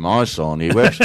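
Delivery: put-on English accent slipped into Liverpudlian; badly aimed Liverpool accent. Joke teller straying from Englishman, Liverpool accent.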